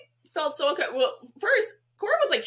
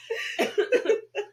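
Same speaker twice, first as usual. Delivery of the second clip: breathy high laugh; 13 semitones higher, 3 dB louder than usual. Real, not acted. Laughing fit.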